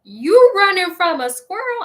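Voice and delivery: high, loud teasing question; jeering friend calling out; mocking friend. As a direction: needle her like a laughing friend. Friends mocking her fear.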